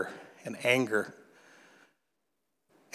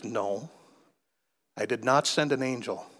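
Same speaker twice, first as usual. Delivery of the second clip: hushed retelling of God's answer, sinking to a whisper; 2 semitones lower, 6 dB quieter than usual. Retold or imitated. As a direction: speak softly as God, pausing, ending almost whispered. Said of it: "I heard the voice again".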